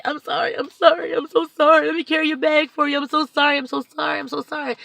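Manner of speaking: over-the-top apologetic, pleading; gushing apologies. Retold or imitated. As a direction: keep apologizing in a high rush. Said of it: "I just literally say".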